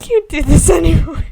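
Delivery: breaking up with loud laughter; laughing. Real, not acted. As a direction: give up mid-laugh. Cracking up, giving up.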